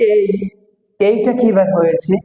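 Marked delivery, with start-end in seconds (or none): 0.1-0.2 "এই" as an e-to-i glide; corrected letter A. Shown as a demonstration. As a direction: glide clearly through "এই". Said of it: "উচ্চারণ হবে". ("এ" থেকে "ই"-তে টেনে "এই")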